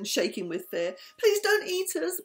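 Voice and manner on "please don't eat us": high, louder pleading cry; begging. Acted. Turtle begging the shark, high and tearful. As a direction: plead for your life, near tears. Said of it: "begged Turtle".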